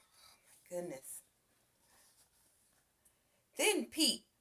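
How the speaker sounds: ordinary delivery, nothing marked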